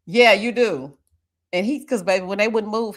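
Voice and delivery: usual speaking voice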